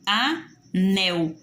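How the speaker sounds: "anel" split A - NEL with a clear pause; teacher's two-beat "a / nel"; syllable by syllable. Shown as a demonstration. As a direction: break "anel" into two separate beats, a... nel. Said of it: "Olha só a minha separação".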